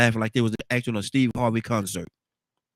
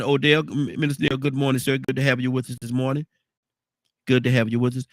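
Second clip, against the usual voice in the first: ordinary talk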